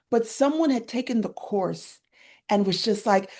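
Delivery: usual speaking voice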